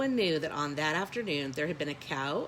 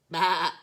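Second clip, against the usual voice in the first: a louder sheep baa; mock bleat; 8 dB louder than usual. A narrator's reading.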